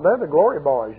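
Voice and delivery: ordinary delivery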